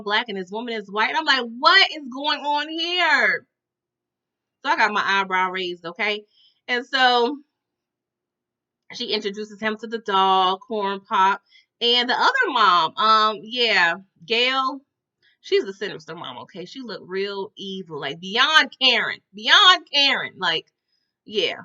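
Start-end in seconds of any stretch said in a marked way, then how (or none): 1.4-3.5 slow, higher-pitched bewilderment; drawn-out disbelief. Retold or imitated. Storyteller reacting to the unexpected new family, slowly incredulous. 18.2-20.3 "beyond Karen" drawn out slowly; slow down and lean on "beyond Karen"; emphatic repetition. Real, not acted. Describing the sinister mom, "beyond Karen" slow and emphatic.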